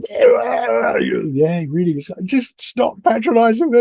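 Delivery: high, loud mock retort; mock yell. Him under the scope, yelling back at the staff, hammed-up and high. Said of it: "You go".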